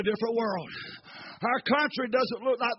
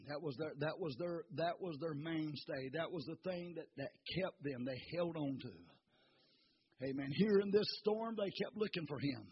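hushed preaching; 7 semitones lower, 14 dB quieter than usual. Acted. Preacher hushing the church before the storm ends, softly. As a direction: pull them in close, barely above a breath.

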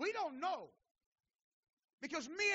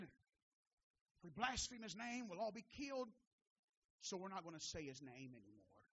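quiet, sinking voice for their resolution; hushed quote; 5 semitones lower, 8 dB quieter than usual. Retold or imitated. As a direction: voice their vow in a hush. Acts out their fearful resolution.

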